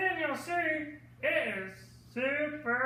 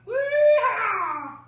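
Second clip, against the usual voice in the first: higher, louder cowboy whoop; 6 semitones higher, 7 dB louder than usual. Pretend. Puppet Mike whooping goodbye to the kids, loud gleeful cry. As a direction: let out a happy yell.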